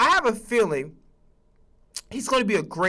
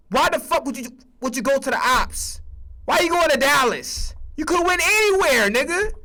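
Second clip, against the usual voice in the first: exasperated rant; 7 semitones higher than usual. Real, not acted. Berating the coach for joining Dallas.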